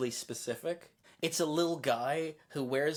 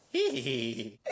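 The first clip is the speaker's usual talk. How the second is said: lower, giggling jester; 3 semitones lower than usual. Acted. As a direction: impish court jester giggle. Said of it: "that was my jester voice".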